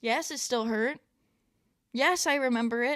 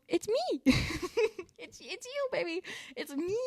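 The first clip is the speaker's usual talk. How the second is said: put-on tender baby talk; 7 semitones higher than usual. Greeting her inner child, high and tender. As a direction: coo it like to a small child. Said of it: "And then I go".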